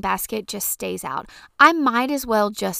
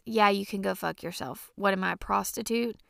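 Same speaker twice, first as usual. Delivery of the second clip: ordinary delivery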